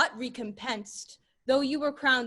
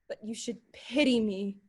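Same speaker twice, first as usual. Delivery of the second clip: usual speaking voice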